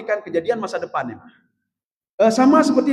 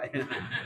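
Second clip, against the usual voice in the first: a quick quiet chuckle; short soft laugh; 10 semitones lower, 16 dB quieter than usual. Real, not acted. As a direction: laugh softly at your own joke. Preacher laughs at own joke. (tawa kecil yang pelan)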